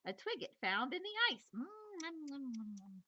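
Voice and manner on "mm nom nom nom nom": munching noises. Acted. Beaver munching a twig.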